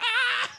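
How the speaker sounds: high, loud mock shriek of laughter; imitated shriek. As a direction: shriek like friends hearing outrageous gossip. Imitates friends hearing the voicemail.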